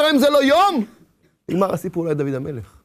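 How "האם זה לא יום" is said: high, lifted question on יום; David's faithful question. Retold or imitated. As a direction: ask "האם זה לא יום?" high and trusting. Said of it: "היה דוד המלך היה אומר".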